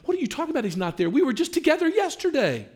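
high-pitched imitation of an upset caller; mock tearful protest. Other woman on the phone to the wife, mock tearful. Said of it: "started crying and saying".

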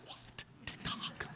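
whispered, surprised mutter; mock whisper. Pastor imitating congregation's whispered surprise. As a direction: hush it like a shocked churchgoer. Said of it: "some of your faces being like".

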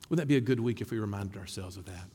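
ordinary talk

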